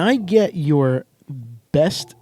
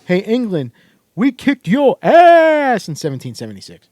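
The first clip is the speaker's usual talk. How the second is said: loud, high-pitched playful jeer; mock taunt; 5 semitones higher, 7 dB louder than usual. Host jokingly taunting England with a loud mock boast. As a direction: crow it at a rival in jest.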